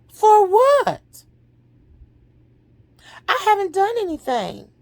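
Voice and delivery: high, loud mock indignation; indignant retort. Mother answers being told she needs forgiving, indignant retort. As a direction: protest innocence, voice jumping up.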